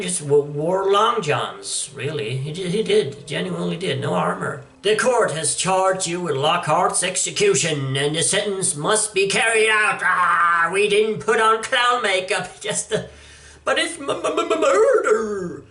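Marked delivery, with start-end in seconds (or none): 4.8-15.6 higher, hammed-up comic villain; lightly voiced gloating crook. Reading Two-Face dialogue in voice.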